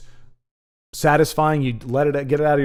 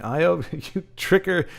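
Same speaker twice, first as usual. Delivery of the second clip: normal talking voice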